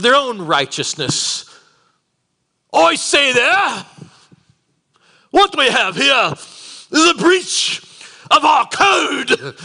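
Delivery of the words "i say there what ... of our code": loud, high, hammed-up British accent. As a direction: proclaim it slowly in a mock British voice. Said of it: "default to the British accent".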